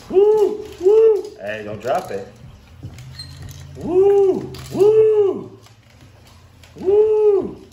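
high, loud whooping; excited whoops. Real, not acted. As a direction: whoop with delight. Cheering at the fish tank.

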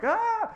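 short high mock shriek of a woman; imitated woman's scream. Retelling the flooded studio, a mock high shriek. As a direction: mimic the woman screaming at the burst pipe. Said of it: "a woman screaming in the background".

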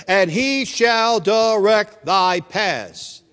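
slow, deliberate reading with a loud middle; solemn scripture reading. Acted. Preacher slowly reading Proverbs to the congregation, solemn and deliberate. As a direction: lean on every word of the proverb.

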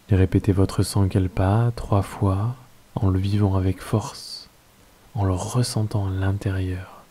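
calm, unhurried Yoga Nidra guidance with long pauses; guided meditation. Acted. Teacher settling listeners into Yoga Nidra, guided meditation voice.

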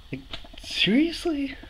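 high, drawn-out disbelief; can you believe this, slowly; slow high exasperated disbelief; incredulous. Real, not acted. Exasperated at clerk running away.

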